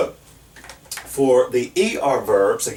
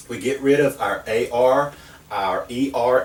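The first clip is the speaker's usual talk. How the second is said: ordinary talk, nothing marked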